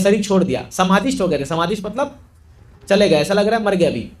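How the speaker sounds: ordinary talk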